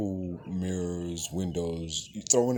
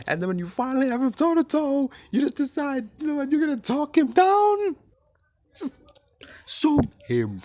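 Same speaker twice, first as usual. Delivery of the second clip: much higher and louder, yelling in real anger; furious rant; 7 dB louder than usual. Real, not acted. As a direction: shout it like you can't believe he won't shoot. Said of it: "pisses me the fuck off to no end".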